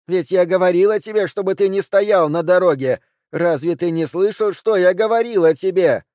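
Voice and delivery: high furious roar at her husband. A narrator's reading. Wife roars at the husband she shot, furious. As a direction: bellow it out in a rage. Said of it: "и она заревела".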